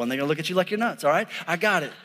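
ordinary talk

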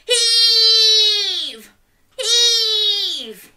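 loud, high effortful heave; heaving shout. Pretend. Leading children pulling the turnip, effortful shout. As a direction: shout it as you pull.

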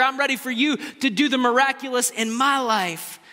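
high, impassioned preacher's cry; preaching at full force. Acted. Preacher pleading before the congregation, impassioned. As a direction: pour it out as a bold vow to the Lord.

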